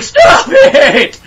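very high, loud shriek; squealing victim. Pretend.

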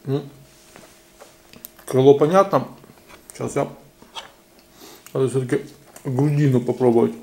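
words muffled by chewing; talking while chewing. Real, not acted. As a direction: mouth full of food. Seen 3: Cheeks bulge, lips pursed chewing throughout; bit chicken at -5.

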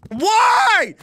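high, loud cry of frustration; high anguished shout; exasperated wail. Real, not acted. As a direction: wail it in real despair at the game. Despairing over the game.

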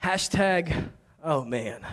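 low, soft aside of dismay; mock dismay. Preacher reacting to Saul sending the boy, quiet mock dismay. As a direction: drop it low and quiet, comically resigned.

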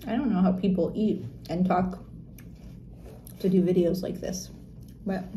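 muffled through napkin; talking behind hand. Real, not acted. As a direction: mouth partly covered while chewing. Seen 0: Napkin-holding hand covers mouth most of the time; chewing only likely.

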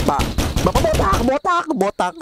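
high-pitched hammed-up cry to dad; mock frantic calling. Calling out to a fallen bald dad.

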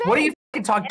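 usual speaking voice

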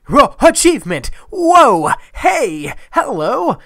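high, loud exclamations; excited surprise. Real, not acted. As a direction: yelp in delight at a surprise reward. Surprised by an achievement popup.

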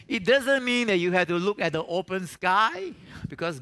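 loud, high preaching holler; hollering revival preacher. Acted. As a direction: preach it full force.

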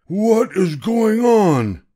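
outraged seller drawing out each word; gruff ticket seller crying out. Acted. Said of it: "he cried".

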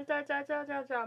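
ordinary delivery

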